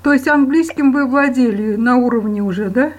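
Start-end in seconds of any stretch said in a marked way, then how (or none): none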